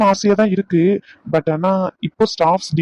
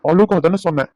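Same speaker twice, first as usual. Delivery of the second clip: ordinary talk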